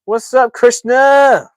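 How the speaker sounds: high, loud, drawn-out hello; shouted greeting. Real, not acted. Streamer greets an arriving viewer, high shouted greeting. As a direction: call out a big, high welcome.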